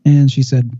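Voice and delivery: normal talking voice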